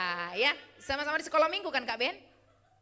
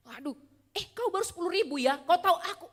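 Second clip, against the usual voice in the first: mock scoffing reply, getting louder at the end; dismissive one-upping; 6 semitones higher, 6 dB louder than usual. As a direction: brush off the crying friend with a boast. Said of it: "jadi kayak gini dia". (nada meremehkan yang dibuat-buat, makin keras)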